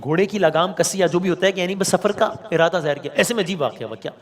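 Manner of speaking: ordinary delivery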